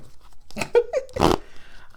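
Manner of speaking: high, airy giggle; real giggle at your own joke; laugh. Real, not acted. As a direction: break into a breathy high laugh. Laughs at her own poker joke.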